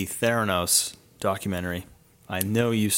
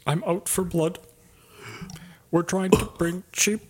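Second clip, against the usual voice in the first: higher put-on executive voice; lightly voiced tech CEO; Elizabeth Holmes voice; 8 semitones higher than usual. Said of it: "trying to sound like her".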